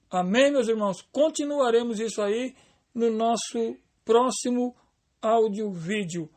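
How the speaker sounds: normal talking voice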